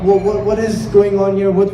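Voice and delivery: ordinary talk, nothing marked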